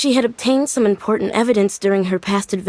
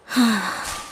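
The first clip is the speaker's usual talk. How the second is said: sigh; 4 dB quieter than usual. Acted. Plant never bloomed.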